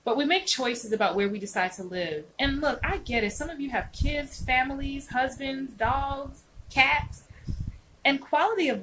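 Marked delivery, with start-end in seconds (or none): none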